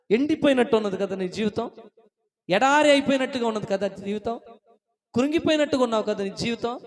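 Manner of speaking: loud, insistent appeal; impassioned preaching. Real, not acted. Preacher names the listener's dried-up life, impassioned preaching. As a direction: drive each phrase home to the congregation.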